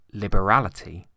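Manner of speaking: plain reading voice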